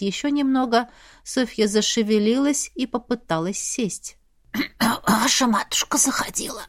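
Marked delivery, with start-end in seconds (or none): 4.5-5.0 throat clearing. Acted. Sick woman clears throat. 5.1-6.6 sick, hoarse whispered voice. Acted. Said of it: "хрипло произнесла она".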